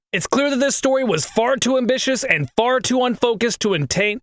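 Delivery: ordinary talk, nothing marked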